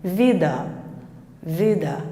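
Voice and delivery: "vida" com o último "a" átono e reduzido; A final fraco. Shown as a demonstration. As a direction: deixe o "a" de "vida" cair, mais grave. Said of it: "Olha só o som". ("vida" com vogal final fraquinha)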